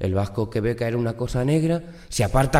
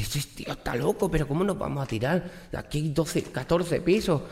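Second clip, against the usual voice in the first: hurried disbelief; 5 semitones higher, 4 dB quieter than usual. Acted. Trapped man argues, panicked.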